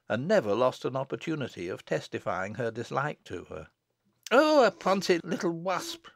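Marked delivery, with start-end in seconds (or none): 4.3-6.1 high, slow scolding outburst; scolding cry. Acted. Mother insulting cheeky child, high scolding cry. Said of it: "cried her mother".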